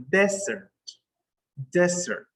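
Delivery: desert stressed on the first syllable, DEZ-ert. Shown as a demonstration. Teacher models the dry place for students, desert stressed up front. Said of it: "that dry place is pronounced".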